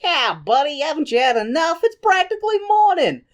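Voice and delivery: put-on high-pitched American wise-guy accent; hammed-up American barkeep, climbing in pitch; American bartender. Bartender to drunk Raoul, exaggerated American accent. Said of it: "the bartender is like".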